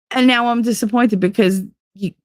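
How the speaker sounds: usual speaking voice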